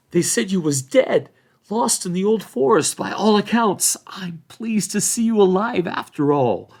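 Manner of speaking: put-on a startled old hobbit, relieved. A narrator's reading. Old Hob voiced.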